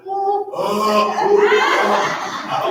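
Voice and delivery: high, loud wailing "ooh"; hammed-up wail for attention; mock loud crying. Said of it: "cry just a little bit louder".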